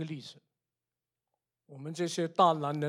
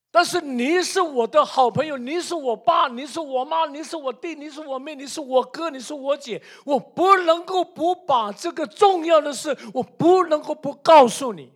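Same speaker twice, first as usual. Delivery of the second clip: raised pitch, pouring out an insistent appeal; impassioned plea; 8 semitones higher, 6 dB louder than usual. Acted. Believer urging a best friend to hear good news, impassioned plea. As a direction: plead loudly, as if it cannot be held back.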